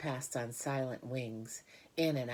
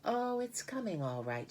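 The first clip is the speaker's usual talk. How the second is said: light; 9 semitones higher than usual. A narrator's reading. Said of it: "she said lightly".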